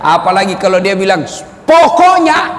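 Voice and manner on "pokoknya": higher, louder "Pokoknya" like a stubborn wife; husband mimicking his wife's final word, mock stubborn. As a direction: snap "Pokoknya" as the wife ending the argument. Wife cuts off any argument.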